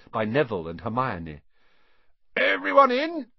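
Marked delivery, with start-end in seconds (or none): 2.4-3.3 high, loud check-in shout. A narrator's reading. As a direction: shout the question to every boat. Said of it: "shouted Hagrid".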